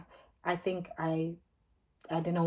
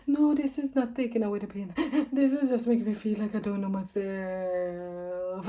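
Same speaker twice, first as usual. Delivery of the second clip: higher, strained, slowing at the end; distressed protest; 3 semitones higher than usual. Retold or imitated. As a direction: protest through the haze. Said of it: "I said to him".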